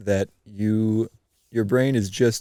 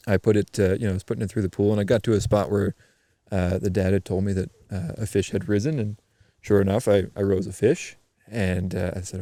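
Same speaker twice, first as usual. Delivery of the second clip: ordinary talk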